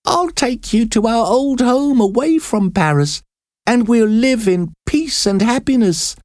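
high, tender promise; loving mother. A narrator's reading. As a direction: soothe your daughter with hope. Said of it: "said Paquette".

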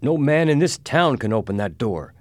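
trembling voice. A narrator's reading. Mister Adams admits nobody can open it, trembling. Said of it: "in a trembling voice".

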